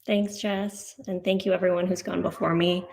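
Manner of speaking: ordinary delivery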